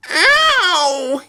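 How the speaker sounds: put-on very high, wailing child's yelp; long high-pitched "ow"; pained yelp. A narrator's reading. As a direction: cry out in pain, way up high. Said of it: "yelped Perfect Peter".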